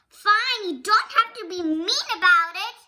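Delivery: put-on a child protesting a mean friend; indignant retort. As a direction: snap back, hurt and annoyed. Maddie snaps back at Belle.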